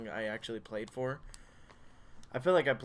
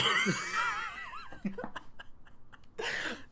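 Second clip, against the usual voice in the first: laughing fit. Real, not acted. Breaks up mid-line.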